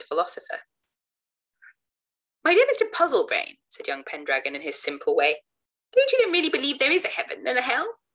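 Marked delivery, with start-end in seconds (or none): 2.4-3.6 put-on earnest young man. Young Pendragon addressing a sceptic. 5.9-8.0 put-on raised, eager young voice, higher; earnest young man. Young Pendragon asks the sceptic, higher and eager.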